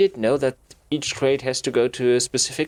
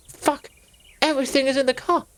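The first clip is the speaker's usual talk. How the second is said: higher, fast dismayed cry; dismayed Anne; 13 semitones higher, 5 dB louder than usual. Acted. Anne seeing the crushed car, higher and fast. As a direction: wail that everything is lost.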